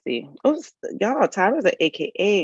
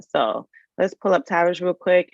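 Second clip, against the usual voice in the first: ordinary talk, nothing marked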